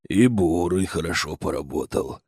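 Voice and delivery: tender. A narrator's reading. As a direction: say it fondly to the machine. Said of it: "ласково произнёс медведь".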